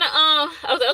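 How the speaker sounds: ordinary talk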